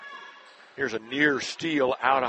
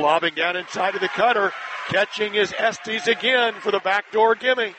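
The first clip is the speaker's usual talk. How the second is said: raised, high-pitched play-by-play shout; excited scoring call; 6 semitones higher, 3 dB louder than usual. Real, not acted. Announcer calling Estes's backdoor basket, excited shout.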